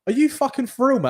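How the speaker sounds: retold incredulous challenge, pitched high; smiling challenge. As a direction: challenge him through a smile, voice lifted. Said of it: "like, smiling, went".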